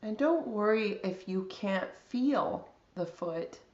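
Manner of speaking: calm, unhurried body scan guidance with long pauses; guided meditation. Real, not acted.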